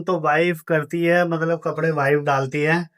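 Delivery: usual speaking voice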